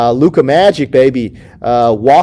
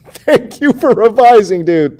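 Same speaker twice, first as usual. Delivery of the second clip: laughing through a higher, louder thank-you; 9 semitones higher, 4 dB louder than usual. Real, not acted. Streamer to a viewer who fixed a question, laughing.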